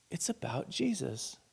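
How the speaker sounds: soft, hushed realization; said to herself. Retold or imitated. Woman repeating it to herself, hushed realization. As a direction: let it sink in, very softly. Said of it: "just to herself".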